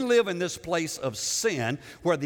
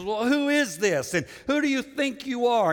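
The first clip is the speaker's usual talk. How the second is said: preacher playing a scornful king, drawing out the words; Pharaoh's tone; 6 semitones higher than usual. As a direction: demand it like an offended ruler. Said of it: "read it in the tone of Pharaoh".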